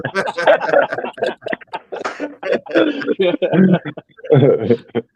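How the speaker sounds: long, high, loud laughing fit; hearty laughter. Real, not acted. Panelist teasing his friend Rock, bursting into long loud laughter. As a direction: crack up loudly. Host cracking up at co-host.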